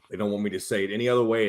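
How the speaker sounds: usual speaking voice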